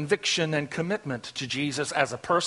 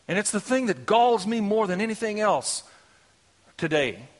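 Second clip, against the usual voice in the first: ordinary talk, nothing marked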